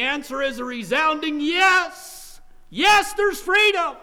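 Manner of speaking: high, loud, slow proclamation; resounding triumph. Real, not acted. Preacher answering his question to congregation, triumphant shout. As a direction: ring out the yes at full voice.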